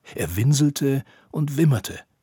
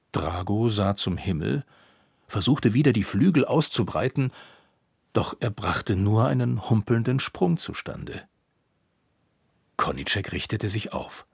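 usual speaking voice

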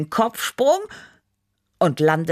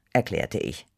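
ordinary delivery

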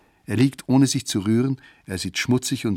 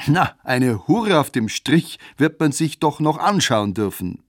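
plain reading voice

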